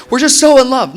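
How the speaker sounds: high, loud gushing imitation; hammed-up lovebird excuse; mock lovestruck. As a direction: mimic a smitten couple, way up high and loud. Mimicking lovestruck couples' excuses.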